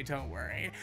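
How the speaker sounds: ordinary delivery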